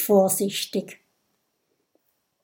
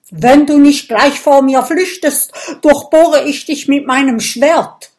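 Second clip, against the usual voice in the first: loud, higher battle threat; threatening shout; 6 semitones higher, 10 dB louder than usual. Acted. Viking threatens the little dragon, loud shout. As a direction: bellow the threat, sword raised.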